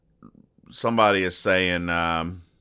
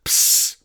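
spray can hiss; 3 dB louder than usual. Retold or imitated. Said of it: "in which she goes".

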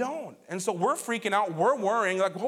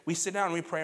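usual speaking voice

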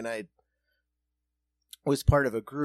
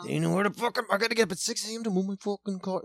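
high, fast mock rant; griping car owner; 6 semitones higher, faster than usual. Friend retells a parking rant, higher fast gripe. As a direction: whine about the early alarm. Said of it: "I'm like".

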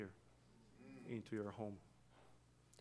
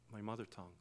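ordinary delivery

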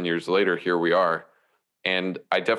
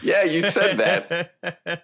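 teasing; 9 semitones higher, much slower than usual. Real, not acted. Teasing a guest about his quote.

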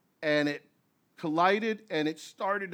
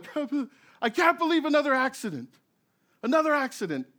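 mock hysterical cry; 9 semitones higher, faster than usual. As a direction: ham up the hysteria, pitched high. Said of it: "this lady's like".

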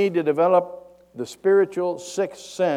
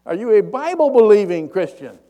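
loud, raised-pitch shouted question; yelling up; 6 semitones higher, 13 dB louder than usual. Retold or imitated. Pastor tests the man on the bridge, yelling up. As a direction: shout up so he can hear you. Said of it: "He yells up at him".

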